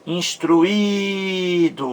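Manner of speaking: Instruído with a very long, high held í; stress on second i. Shown as a demonstration. Teacher showing the segundo I, Instruído with very drawn-out í. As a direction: hold the í of Instruído, slowly, before do. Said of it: "segundo I".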